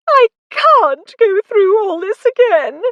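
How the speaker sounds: high, loud despairing wail. A narrator's reading. Lucy wailing at the thought of another wedding, high and loud. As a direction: cry it out in distress. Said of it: "Lucy wailed".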